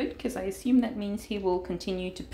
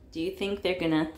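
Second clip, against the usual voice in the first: normal talking voice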